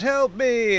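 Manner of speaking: high-pitched villager's plea; crying out for help. Acted. Burning villager calling out for rescue, desperate cry. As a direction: cry out like someone in desperate trouble. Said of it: "this man's crying out".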